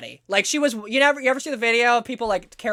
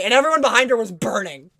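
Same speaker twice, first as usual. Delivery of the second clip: ordinary talk, nothing marked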